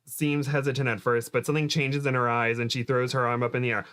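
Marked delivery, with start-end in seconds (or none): none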